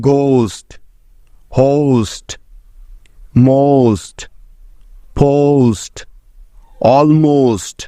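পোস্ট with a gliding "oh-oo" vowel before "st"; teacher modelling the "ost" sound for students; clear model pronunciation. Shown as a demonstration. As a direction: say মোস্ট slowly as "mowst", pausing after each word. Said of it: "এসটি এর কিছু উদাহরণ".

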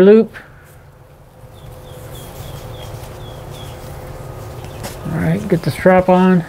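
muffled behind respirator mask; speaking through fabric mask; through a face mask. Real, not acted.

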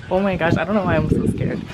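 ordinary talk, nothing marked

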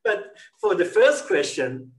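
words through a lingering laugh. Real, not acted. Host resuming the interview still laughing. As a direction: still chuckling as you get back on track.

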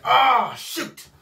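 high, loud "Ah" falling to an unvoiced "shoot"; startled demons. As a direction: yelp it, then let it drop to a breath. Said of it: "they be like".

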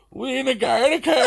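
put-on high, loud slurred drunk mate, laughing through it. Retelling the drunk friend waking in a Garda car, high laughing voice.

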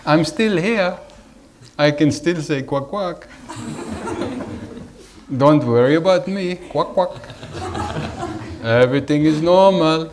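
high, loud, giggling through the words; laughing mock reassurance. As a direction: reassure playfully while laughing. Voicing himself quacking, laughing.

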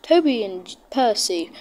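ordinary delivery

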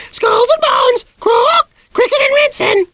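shrieking parrot squawk, very high and loud; parrot screech. A narrator's reading. The parrot screeching at the piano, a shrill squawk. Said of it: "the caterwauling started up again".

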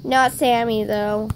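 ordinary talk, nothing marked

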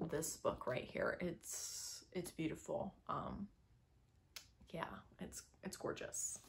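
soft, breathy near-whisper of adoration; whispered fond praise; hushed gushing. Real, not acted. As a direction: hush down as if cradling the book. Gushing softly over favourite book.

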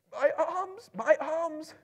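very high moaning wail. A narrator's reading. Bilbo moans while clinging to Dory's ankles, high wailing. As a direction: wail in pain while dangling. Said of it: "He moaned".

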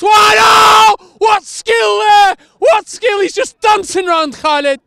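loud, high astonished commentary yell; excited shout. Real, not acted. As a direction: erupt at the skill as if you can't believe it. Stunning dribble in commentary.

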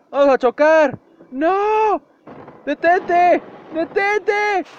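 high, loud shouts; crash panic. Real, not acted. Driver screaming before the vehicle crashes. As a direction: yell to stop before the crash.

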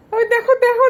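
high laughing look-at-that. Real, not acted. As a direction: laugh while pointing out. Laughing at toddler.

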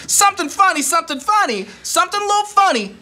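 high-pitched, punchy preacher setup; mock pastor delivery. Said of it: "that hook at the end lands it as a pastor".

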